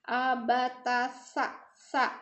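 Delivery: "Abatasa" very slowly, tongue near the teeth on sa; slow teacher drill; slow spelled drill. Shown as a demonstration. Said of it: "Jadi lidahnya di dekat gigi".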